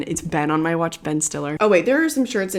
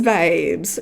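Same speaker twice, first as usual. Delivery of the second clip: normal talking voice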